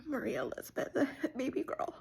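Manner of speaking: high, breaking, soft voice; saying her name through tears; tearful. Real, not acted. Sister tenderly addressing her baby sister, teary. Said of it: "without crying".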